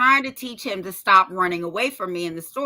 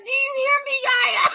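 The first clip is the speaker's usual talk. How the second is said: put-on very high-pitched kid's holler; imitated child yelling; 13 semitones higher than usual. Little boy calling to grandma in public, imitated yelling. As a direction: mimic a little boy demanding to be heard. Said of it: "Yelling it at the top of his".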